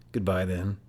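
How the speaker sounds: a lightly growled dismissal; a mild low growl to send him off. A narrator's reading. Yost dismissing the rival runner with a mild growl. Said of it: "growled Yost".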